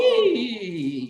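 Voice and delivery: high, loud "eee" voice exercise; lots of sound. Shown as a demonstration. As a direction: pour out a big high "eee". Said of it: "just lots of sound".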